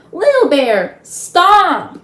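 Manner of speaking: high, loud frantic yell; boy's panicked loud cry of warning; frenzied shout. Acted. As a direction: scream at him to stop before he shoots. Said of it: "Omri's frenzied voice rang out".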